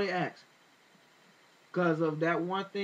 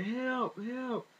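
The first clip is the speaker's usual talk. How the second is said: retold cry for help; 8 semitones higher than usual. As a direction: imitate a desperate call without shouting. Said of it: "you can hear Trayvon screaming".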